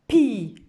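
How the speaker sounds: pi said loud; teacher modelling pi; syllable demo. Shown as a demonstration. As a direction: call out pi. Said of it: "on a écrit la syllabe".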